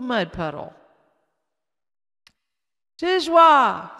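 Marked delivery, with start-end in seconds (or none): 3.0-3.9 put-on high, loud little-child voice; toddler asking. A narrator's reading. Casey reaching for the cereal, high toddler voice. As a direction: ask for it like a toddler. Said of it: "said Casey".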